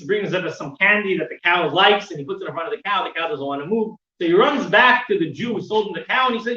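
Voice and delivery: ordinary talk, nothing marked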